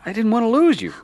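high, loud defensive plea; heated, pleading self-defense; desperate protest. Acted. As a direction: protest at the top of your range. Quarrel, man defends himself.

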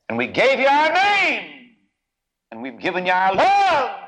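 climbing high and loud, slowing on the words; rising fervor. Acted. Preacher voicing the father, rising to a loud fervent peak. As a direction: swell with a father's pride and love.

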